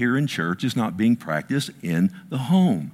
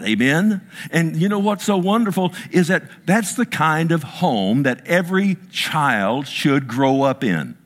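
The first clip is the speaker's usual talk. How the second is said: fervent preaching; 6 semitones higher than usual. Acted. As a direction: preach it to the congregation with fire. Preacher rising to full force.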